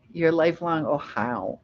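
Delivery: usual speaking voice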